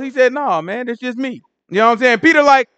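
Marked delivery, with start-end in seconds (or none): 0.3-1.4 casual reassurance. Retold or imitated. Yahushua reassuring disciples.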